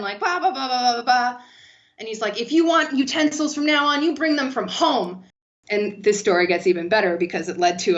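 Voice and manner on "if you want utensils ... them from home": raised, indignant decree from a fed-up boss; imitating an angry boss's announcement; irate boss. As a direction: lay down the law, pitched up. Irate boss's email continues.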